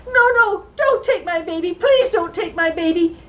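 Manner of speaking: loud, high-pitched pleading; desperate begging. Acted. Mother begs little man to spare baby, desperate. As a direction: beg frantically for the child. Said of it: "I started to beg".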